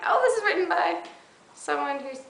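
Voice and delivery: high, loud sudden realization; comically surprised it's already British; mock realization. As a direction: act out the dawning "oh" of discovery.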